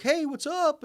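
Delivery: very high, chirpy mock greeting; eager caller. Host mocking an eager cold caller, very high and chirpy. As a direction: play the thirsty salesman, overly bright. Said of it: "he's like".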